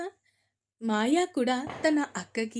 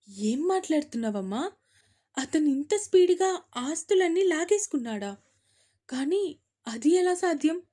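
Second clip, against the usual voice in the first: doubtful; 5 semitones higher, 6 dB louder than usual. A narrator's reading. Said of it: "అడిగింది మిథున డౌట్గా".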